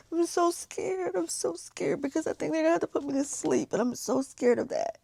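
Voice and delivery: high, trembling and soft; frightened confession; scared. Real, not acted.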